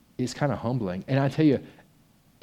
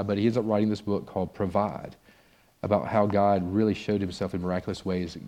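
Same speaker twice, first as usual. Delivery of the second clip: ordinary talk, nothing marked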